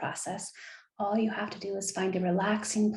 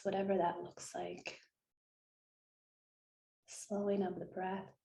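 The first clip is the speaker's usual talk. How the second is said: guided meditation; 8 dB quieter than usual. Acted. Host guiding listeners through visualization, soft meditation voice. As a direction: gently lull listeners into stillness, hushed and unhurried.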